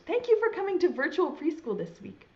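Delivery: ordinary delivery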